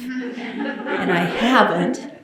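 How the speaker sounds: slow amused laugh through the words; laughing aside. Real, not acted. Joking to listeners she never needed the math, laughing.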